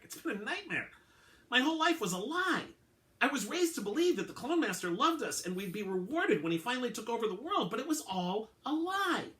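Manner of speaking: high, strained voice of a betrayed clone; anguished clone. Acted. Belitomaj tells the detective his life was a lie, high and anguished. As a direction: pitch it up high as his whole life unravels.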